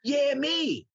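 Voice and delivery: rising to a high, pleased cheer; self-congratulating mock cheer; delighted realization. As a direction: act out happy amazement, pitch climbing. Acting out delighted self-discovery.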